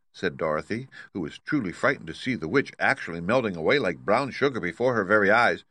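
usual speaking voice

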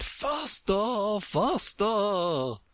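high, slowly stretched queen's cry; urgent cry. A narrator's reading. Queen dragging Alice along, high urgent cry. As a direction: urge her on in a high, long-drawn cry. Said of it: "still the Queen cried".

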